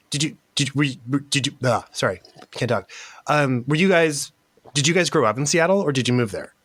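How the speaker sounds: normal talking voice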